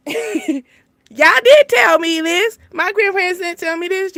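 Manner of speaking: loud, slow, high laughing protest; laughing outburst. Real, not acted. Venting to viewers about adult life, laughing loudly.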